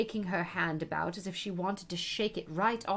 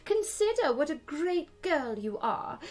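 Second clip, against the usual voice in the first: higher, urgent Queen's plea; despairing Queen; 9 semitones higher, 4 dB louder than usual. A narrator's reading. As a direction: plead with her, flustered. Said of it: "wringing her hands in despair".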